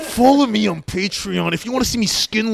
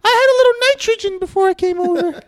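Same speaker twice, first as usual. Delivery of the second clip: much higher squeaky helium voice. Pretend. Joking he inhaled gas, squeaky helium voice. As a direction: sound like you sucked helium. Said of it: "does hydrogen make your voice loud".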